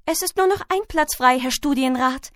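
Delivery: high-pitched, dutiful schoolboy; eager top pupil. Acted. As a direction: report eagerly to the teacher. Top pupil reports to the teacher.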